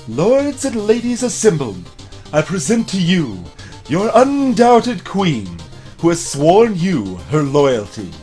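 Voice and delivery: herald proclamation. Acted. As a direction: proclaim to the whole court. Herald presents the queen.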